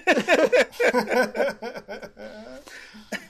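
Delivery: long high laughing fit; real laughter. Real, not acted. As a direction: crack up, trailing away slowly. Laughs at co-host's story.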